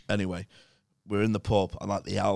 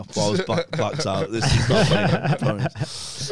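ordinary talk, nothing marked

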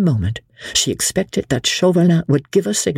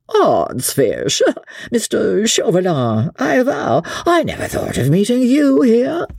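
foppish, much higher, with a laugh; drolly affected; 8 semitones higher than usual. Acted. Percy greeting his enemy Chauvelin, foppish and amused. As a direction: play the lazy dandy, amused. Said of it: "in his own drolly affected way".

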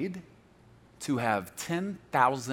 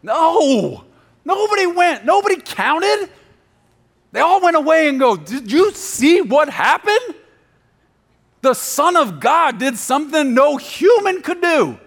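shouted, much higher and louder preaching; preaching at full force; 14 dB louder, slower than usual. Acted. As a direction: belt it out to the whole church.

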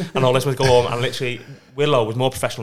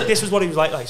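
ordinary talk, nothing marked

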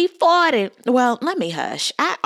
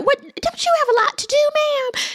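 much higher, squeaky mock voice at Candace; mocking squeal. Host mocking Candace for picking fights, squeaky high mock voice. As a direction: squeal it like a scandalised girlfriend.

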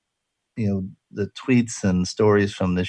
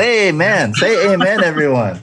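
higher, louder call to the congregation; preacher voice; 8 semitones higher, 6 dB louder than usual. Shown as a demonstration. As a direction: rouse the crowd like a preacher. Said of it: "with a preacher voice".

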